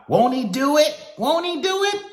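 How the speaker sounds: loud falsetto whoop, imitating excited churchgoers; high-pitched hammed-up praise shout; mock believers' cheer. Imitating people posting praise about God, hammed-up squeal. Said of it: "haven't you ever seen people go".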